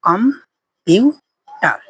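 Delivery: Computer stretched very slowly into its three parts; slow syllable by syllable. Shown as a demonstration. Teacher showing students the three syllables, stretched out slowly. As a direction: draw out computer slowly, com-pu-ter. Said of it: "তিনটা অংশ।".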